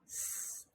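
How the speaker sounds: a soft hissed "S-" with no vowel; just hiss "S-" on its own; isolated s sound. Shown as a demonstration. Teacher showing the s sound to the class. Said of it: "what letter makes the s sound".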